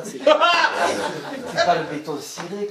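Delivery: slow, laughing through the words; chuckling at his own situation. Real, not acted. Amused at the factory door, laughing slowly.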